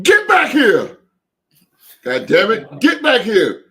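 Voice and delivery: loud, high yell at players walking off; coach yelling. Retold or imitated. Coach shouting at his team as they leave, loud yell. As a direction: bark them back like an angry coach.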